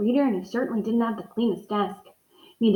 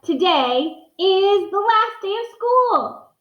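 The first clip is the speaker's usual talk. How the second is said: excited; 9 semitones higher, much slower than usual. Acted. Gilbert excited about last day.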